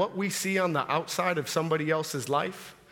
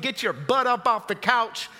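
ordinary talk, nothing marked

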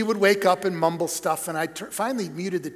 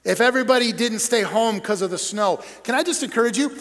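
ordinary talk

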